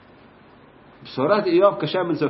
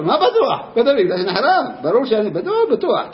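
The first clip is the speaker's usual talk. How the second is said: laughing insistence; 8 semitones higher, 3 dB louder than usual. Retold or imitated. Teacher laughing at question.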